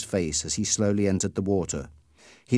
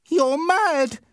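high, loud shout; 6 dB louder than usual. Acted. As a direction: yell it at the bully in disbelief. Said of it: "Peter shouted".

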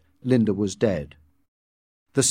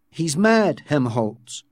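plain reading voice

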